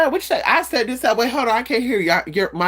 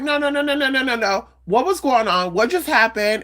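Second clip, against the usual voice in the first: higher, insistent no's; firm objection; 6 semitones higher than usual. Retold or imitated. Stopping the man's subject change, insistent no's. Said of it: "I was like".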